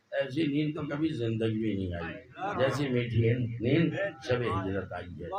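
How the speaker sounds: normal talking voice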